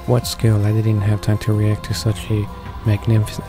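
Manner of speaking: ordinary talk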